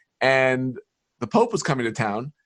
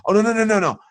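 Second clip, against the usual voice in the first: panicked backpedal; 8 semitones higher than usual. Retold or imitated. Panicked correction after shocking everyone.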